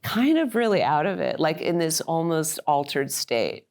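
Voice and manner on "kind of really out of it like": ordinary talk, nothing marked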